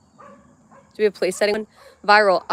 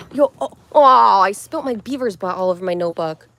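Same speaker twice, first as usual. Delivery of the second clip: startled; 5 dB louder than usual. Real, not acted. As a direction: caught off guard by a spill. Spill accident.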